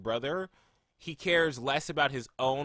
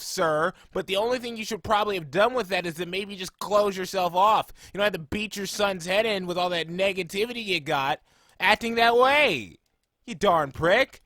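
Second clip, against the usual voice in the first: higher, louder scolding rant; all-caps rant; 8 semitones higher, 8 dB louder than usual. Retold or imitated. Fan yelling at a neglectful anime father, angry and loud. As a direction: chew out the father as if shouting in caps. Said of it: "typed out in all caps".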